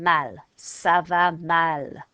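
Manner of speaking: "Ça va mal" drawn out, mal said mal; slow French ça va mal. Shown as a demonstration. Teacher modelling feeling bad in French, slow. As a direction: stretch "mal" slowly for copying. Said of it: "the word for bad".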